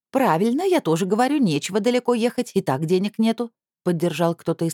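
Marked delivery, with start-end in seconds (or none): 0.1-3.5 higher, eager agreeing voice; voice from the crowd. A narrator's reading. Someone in the crowd eagerly backs her up. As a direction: chime in from the crowd.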